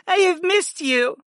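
high, tearful princess voice; young woman holding back tears. Acted. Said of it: "the warrior princess sniffled".

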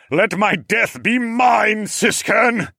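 high, loud, slow dying plea; shouted plea. Acted. Dying regent begging his brother, shouting. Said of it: "the dying regent shouted his last plea".

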